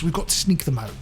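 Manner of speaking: usual speaking voice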